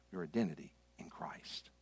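low, hushed whisper. Acted. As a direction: drop to a whisper to drive it home. Sermon point ending in whisper.

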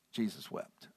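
soft, low, slow reading of Jesus wept; hushed reading. Shown as a demonstration. Preacher reading the shortest verse, hushed and low. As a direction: let it fall quietly. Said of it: "the shortest verse in the Bible".

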